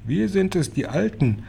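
put-on high, thin old person's chirp; feeble chirping voice. A narrator's reading. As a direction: chirp weakly from behind the door. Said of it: "zirpte eine schwache Stimme".

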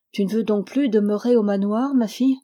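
ordinary delivery, nothing marked

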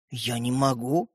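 low, soft, drawn-out admission; reluctant. Acted. Стёпа unable to give the toy back, reluctant. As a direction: back out slowly and quietly.

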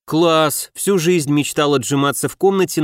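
ordinary delivery

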